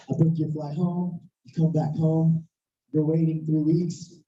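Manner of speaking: usual speaking voice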